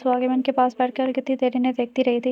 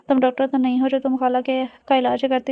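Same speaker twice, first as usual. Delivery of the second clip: ordinary delivery, nothing marked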